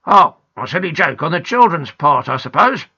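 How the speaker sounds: a touch of disapproval; cross policeman. A narrator's reading. Policeman blaming the children's joke, mildly cross. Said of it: "looking crossly at the twins".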